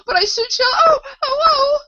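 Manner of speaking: very high, loud wail into shrieks; wail, then scream out each cry. A narrator's reading. The Queen dreading the pinprick, wailing and screaming. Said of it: "Her screams were so exactly like".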